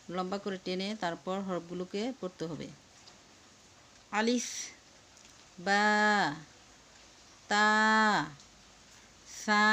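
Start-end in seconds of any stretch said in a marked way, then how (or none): 4.1-9.6 hold আলিফ, বা, তা, সা two to three seconds each; drawn-out letters. Shown as a demonstration. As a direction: stretch every letter an alif's length. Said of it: "দুই তিন সেকেন্ডের মতো টেনে পড়তে হবে". (আলিফ, বা, তা, সা দুই তিন সেকেন্ড টেনে পড়া)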